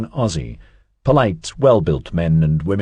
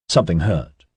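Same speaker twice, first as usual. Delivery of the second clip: plain reading voice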